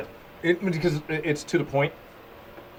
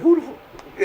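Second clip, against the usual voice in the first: high, cut-off exclamation; amazed; 12 semitones higher, slower than usual. Retold or imitated. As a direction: break off in astonishment. Amazed at player on field.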